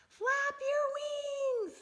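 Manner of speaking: very high, slow, stretched-out cheer; calling encouragement. Acted. Missus Bird cheering Junior as he jumps, high and drawn out. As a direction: sing it out long to urge him on. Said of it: "said Missus Bird".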